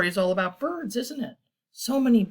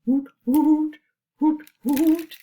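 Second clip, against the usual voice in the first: high, loud hoots; owl hoot; 8 semitones higher, 7 dB louder, slower than usual. Acted. Asking children to join in, owl hooting. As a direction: call like an owl, high and strong. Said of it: "Is that what an owl says?".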